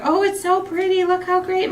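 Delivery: high, lightly gushing praise; mildly hammed-up admiration; imitated delight. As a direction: coo over it as if showing it off. Retelling their delight over lights.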